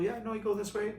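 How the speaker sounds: high, friendly mimicked local; acted-out cheerful direction-giving, high voice; imitated helpful women. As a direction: pitch it up like a helpful stranger.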